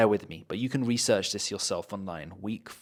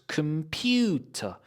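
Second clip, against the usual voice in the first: "Computer" slowly, with high stress on PU; syllable stress; 5 semitones higher, slower than usual. Shown as a demonstration. Teacher showing micro stress, slow com-PU-ter. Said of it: "it will have stressed and unstressed syllables".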